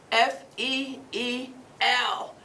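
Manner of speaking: slow, deliberate letter by letter; spelling out. Retold or imitated. Coach retelling what her student painted, spelling slowly. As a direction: spell it out, each letter drawn out.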